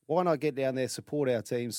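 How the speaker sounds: ordinary delivery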